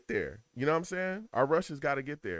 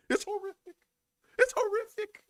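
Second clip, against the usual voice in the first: very high, squealed verdict; high-pitched, cracking-up despair; exasperated disbelief. Real, not acted. Laughing despair at bad offense.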